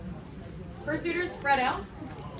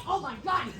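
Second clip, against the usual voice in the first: usual speaking voice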